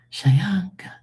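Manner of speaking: high airy whisper, teasing; whispered "Vamos aquí", high and unvoiced; playful whisper. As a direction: breathe the words like a secret joke.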